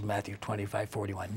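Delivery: ordinary talk, nothing marked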